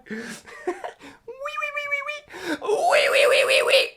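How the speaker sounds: high, loud squealing laughter; shrieking laugh. Real, not acted. Singer cracking up at her own high voice.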